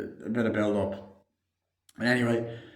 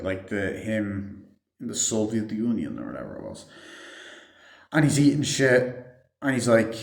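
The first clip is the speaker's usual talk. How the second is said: ordinary delivery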